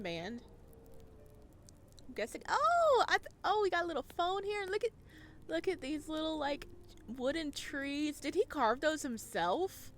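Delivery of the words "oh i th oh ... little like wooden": high, loud and fast excited chatter; excited discovery. Real, not acted. Streamer spotting details in the art, high and excited. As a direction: rattle off what you spot.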